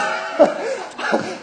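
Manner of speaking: short high laugh; real burst of laughter. Real, not acted. Preacher telling of the visiting pastor, real high laugh. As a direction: crack up mid-story.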